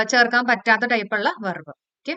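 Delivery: normal talking voice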